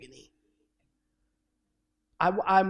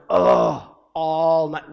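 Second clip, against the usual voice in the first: retold groan; 2 semitones lower, 10 dB louder, much slower than usual. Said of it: "and I kept going".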